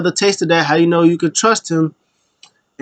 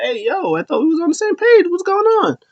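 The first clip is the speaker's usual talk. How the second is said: much higher and faster, protesting; betrayed Pierce; faster than usual. Retold or imitated. Pierce reacts to being blamed, high and indignant. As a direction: squeal it out like you're stunned.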